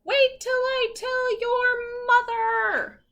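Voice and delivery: sing-song warning from the neighbor; scolding neighbor. Acted. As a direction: neighbor threatening to tell Floyd's mom. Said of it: "And this person says".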